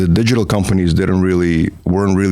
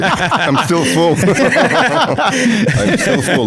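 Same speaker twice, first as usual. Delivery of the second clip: laughing through the words, pitched way up; much slower than usual. Real, not acted. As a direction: giggle it out, still stuffed from the meal. Laughing about last night's barbecue.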